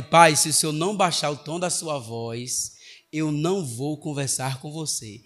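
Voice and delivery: slow, measured warning; retold teacher's controlled ultimatum; firm ultimatum. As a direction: set a limit slowly and deliberately. Teacher's ultimatum to shouting father.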